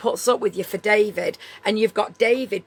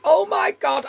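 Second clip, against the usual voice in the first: much higher, louder disbelief; shocked gasp; 13 semitones higher, 4 dB louder, slower than usual. Retold or imitated. Hearing the gossip news, high shocked cry. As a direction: blurt it out in pure shock. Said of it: "I was absolutely like".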